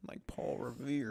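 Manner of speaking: slow mock Southern drawl; imitating Southern accent. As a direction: copy her country twang. Mimicking her country line.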